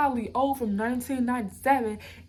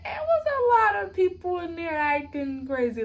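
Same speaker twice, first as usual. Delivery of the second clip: teary; 6 semitones higher, 5 dB louder than usual. Real, not acted. Recalling a scary jail scene.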